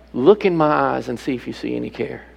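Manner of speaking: normal talking voice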